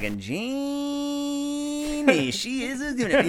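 high, mock Boston drawl on Jeannie; hammed-up Boston accent, high. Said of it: "he has a Boston accent".

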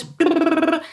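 buzzing lips trill brrr. Shown as a demonstration. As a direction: blow a lip trill, high. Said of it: "which are our lip trills".

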